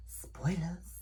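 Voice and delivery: a teasing unvoiced whisper of the word; whispered spoilers. To viewers before the spoiler recap, whispered. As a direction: hush it like a secret warning.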